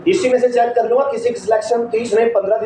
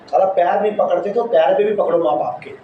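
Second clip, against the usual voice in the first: usual speaking voice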